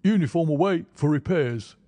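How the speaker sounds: barked stiff soldier line; stiff barking toy soldier. A narrator's reading. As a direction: bark it like a drilled marine. Sergeant continues answering.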